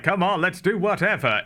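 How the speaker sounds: lightly acted show of willingness; outward nonchalance. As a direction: act game for anything. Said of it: "Outs-, outwardly, Gooch is like".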